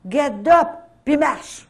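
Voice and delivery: retold healing command to the sick man, loud. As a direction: order him up like a healer. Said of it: "j'ai dit, là".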